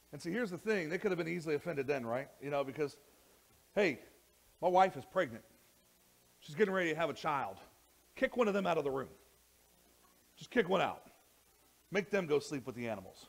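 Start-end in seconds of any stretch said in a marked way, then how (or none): 3.7-13.3 loud, high, indignant father demanding a room; an outraged father demanding a room for his wife; mock angry demand. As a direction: bark at the innkeeper for a room. Imagined angry Joseph at inn.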